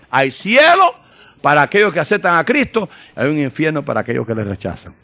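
high, loud preacher's proclamation; preaching at full force. Acted. (proclamación aguda y fuerte)

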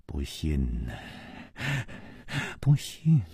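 soft, barely voiced repeated murmur. A narrator's reading. Dying man murmuring, deaf to Chu Liuxiang, soft and faint. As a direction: mumble it over and over to yourself. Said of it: "不住地喃喃地说".

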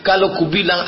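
ordinary delivery

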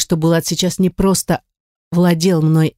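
ordinary delivery

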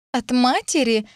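high, incredulous scornful question; disdainful scoff. A narrator's reading. Lilith questions the mother's keepsake, disdainfully. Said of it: "пренебрежительно фыркнула Лилит".

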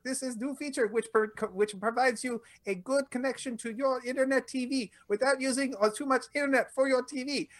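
a deliberately bad Indian accent, like a mock ad read. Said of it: "That's the bad accent".

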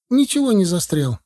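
put-on high, mildly indignant boy; furious objection. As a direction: deny it hotly, pitch jumping up. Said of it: "возразил яростно мальчуган". (яростно возразить, по-мальчишески)

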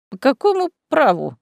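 high, tipsy protest; hiccuping drunk. A narrator's reading. Drunk mayor questions his arrest, hiccuping. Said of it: "икнул мэр".

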